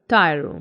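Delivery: "टायरो" with no push on the end; no stress on ending. Shown as a demonstration. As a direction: say "टायरो" without pressing the end. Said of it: "इस पर pressure नहीं देंगे". ("टायरो" बिना ज़ोर के)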